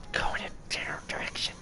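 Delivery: hushed, urgent whisper; desperate whisper; pleading whisper. Real, not acted. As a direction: coax it under your breath. Whispered plea to puppet.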